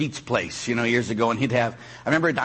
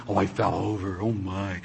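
low, softer mock wonder; mock awe; 6 semitones lower, 4 dB quieter than usual. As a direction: lightly mimic someone overawed. Mocks seekers of weird experiences.

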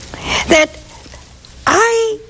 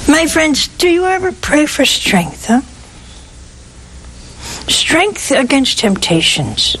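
ordinary talk

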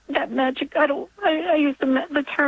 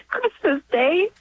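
real tearful, higher-pitched voice; emotional; 5 semitones higher than usual. Real, not acted. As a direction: choke up with gratitude as you speak. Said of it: "I wasn't expecting to get so emotional".

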